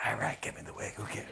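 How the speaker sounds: soft, near-whispered Bruce; Bruce giving in. Retold or imitated. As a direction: shrug it off in a hushed Bruce voice. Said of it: "He goes".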